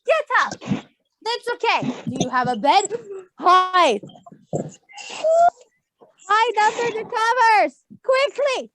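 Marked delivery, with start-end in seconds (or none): none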